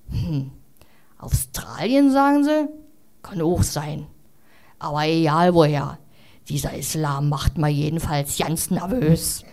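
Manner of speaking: a hint of a suspicious busybody; grumpy old neighbour.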